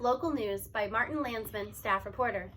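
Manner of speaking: usual speaking voice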